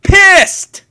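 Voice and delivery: furious shout. Acted. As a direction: explode with rage. Girl master blows up.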